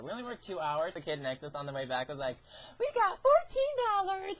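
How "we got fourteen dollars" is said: high, loud squeal imitating a kid; excited kid. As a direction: shriek it like a thrilled child.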